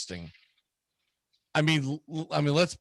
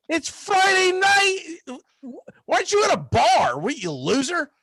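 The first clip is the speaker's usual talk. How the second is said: mocking jeer; 4 dB louder than usual. Mocking the lonely old fan.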